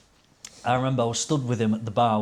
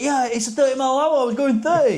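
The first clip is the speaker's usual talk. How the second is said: much higher, put-on voice of the biker making excuses; mock defensive biker; 12 semitones higher, 5 dB louder than usual. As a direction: play the biker insisting, hammed-up and high. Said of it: "He was like".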